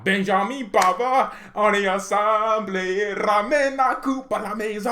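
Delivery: high, loud French rallying cry; rally the team in mock French; hammed-up French accent.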